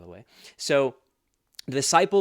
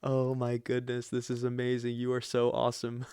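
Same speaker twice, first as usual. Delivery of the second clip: mock mesmerized. Imagining the Messiah right in front of her, mock mesmerized. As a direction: gush in an entranced, level drone. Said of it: "I'd be mesmerized".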